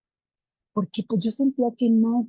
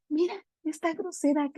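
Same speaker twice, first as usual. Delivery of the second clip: lightly voiced mother, higher and softer; imitating a mildly amused mom, pitched up; mamá divertida; 8 semitones higher, 6 dB quieter than usual. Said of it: "hasta se rió como". (mamá divertida, voz aguda y suave)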